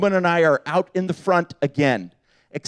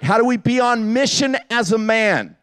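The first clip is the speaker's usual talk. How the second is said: ordinary delivery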